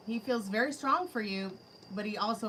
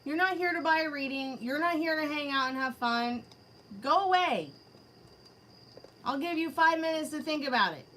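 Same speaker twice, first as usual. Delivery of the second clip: higher, louder, firm scolding; stern dismissal; 5 semitones higher, 5 dB louder than usual. Real, not acted. Reader sends the troll away from her chat. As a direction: order them out, fed up.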